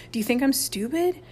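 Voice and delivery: indignant question, rising high; furious howl. A narrator's reading. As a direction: demand it, insulted. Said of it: "he howled furiously".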